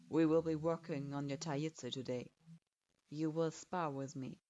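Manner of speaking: hard, clipped authority of a teacher; lightly stern sensei. Acted. Sensei orders.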